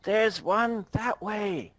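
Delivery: loud, slow distant shout; yelling. Acted. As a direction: call out to the other guards. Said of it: "you hear someone yell".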